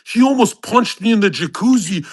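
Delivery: ordinary talk, nothing marked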